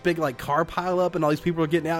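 usual speaking voice

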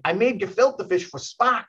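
quick imitation of a New York accent. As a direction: do Abby Hoffman's New York accent, proud. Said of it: "he's got this thick New York accent".

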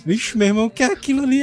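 surprised. Acted. Batman sees a light trail.

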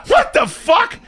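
high incredulous squawk; stunned disbelief. Retold or imitated. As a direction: blurt it out in total disbelief. Stunned reply to TikTok guy.

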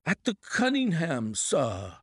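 high, urgent servant's voice; alarmed butler. Acted. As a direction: deliver the bad news in a rush. Butler figure.

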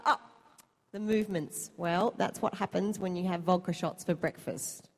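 usual speaking voice

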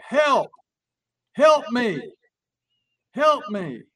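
high mock cries for help; yelling for help. Stranger in a Walmart parking lot, yelling for help. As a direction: call out across a parking lot. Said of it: "somebody yelling".

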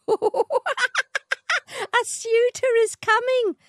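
very high, cackling bird voice; a kookaburra squawking a prophecy. A narrator's reading. Kookaburra announcing a suitor, high bird voice. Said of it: "it said".

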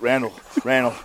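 low, beaten-down plea to quit; a worn-out fighter pleading between rounds; desperate begging. Retold or imitated. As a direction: beg your corner man to let you stop, voice sunk low. Begging his corner man to stop.

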